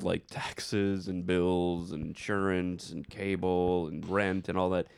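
ordinary talk

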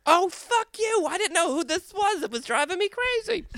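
hammed-up shrill aunt; shrieking aunt. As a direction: screech it in relief and outrage. Aunt recognizing the caller.

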